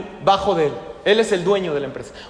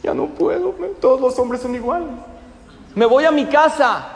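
high, loud mock-tearful wail; a secretary breaks down in tears after the scolding; tearful outburst; 6 semitones higher, 3 dB louder than usual. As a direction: cry out that she can't take it. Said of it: "empieza a llorar".

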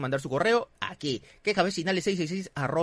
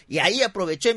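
ordinary talk

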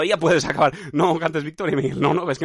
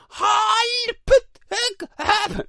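very high squeaky shouted yelps; clown pain cry; much slower than usual. Pretend. Clown shot in the stomach, high squeaky shouted yelps. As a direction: yelp like a clown instead of screaming. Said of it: "Y en vez de gritar, hace".

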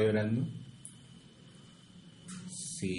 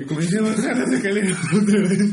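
laughing hard through the words, higher and louder; 9 semitones higher, 8 dB louder than usual. Real, not acted. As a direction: crack up while telling it. Laughing while retelling the chase. (riendo a carcajadas mientras cuenta)

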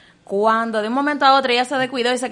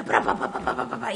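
whispered can clatter; 12 semitones lower, 7 dB quieter than usual. Retold or imitated. Imitating falling cans.

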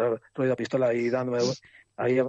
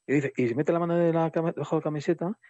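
ordinary delivery

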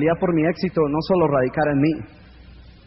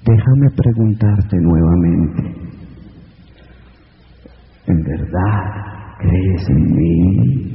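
muy grave, lenta y más fuerte, solemne; voz de arriba; 10 semitones lower, 5 dB louder, much slower than usual. Acted. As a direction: habla como una voz divina desde lo alto. La voz divina pregunta. (la voz de arriba responde al hombre, grave y lenta)

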